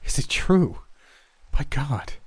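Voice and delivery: shocked. Acted. Shocked at the revelation.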